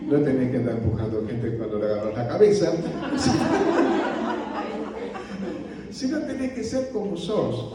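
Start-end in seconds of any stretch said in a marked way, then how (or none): 2.7-7.7 laughing. Real, not acted. Laughing after his own joke.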